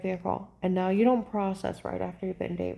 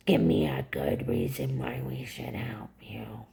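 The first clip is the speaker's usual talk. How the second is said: very low, slow voice imitating an agent; menacing agent; 11 semitones lower, slower than usual. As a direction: drag out the demand, deep and slow. Agent demanding reason, retold.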